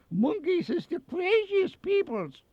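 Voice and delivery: high, exaggerated Bavarian-accented announcer. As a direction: squeeze out a hammed-up Bavarian accent. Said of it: "with a rich Bavarian accent".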